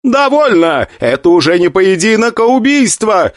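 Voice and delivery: high, outraged shout; indignant. Acted. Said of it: "возмутился Джен Ли".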